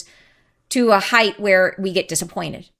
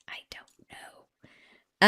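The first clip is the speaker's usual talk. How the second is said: whispered aside; 10 dB quieter, much slower than usual. Real, not acted. Unsure, muttering to herself.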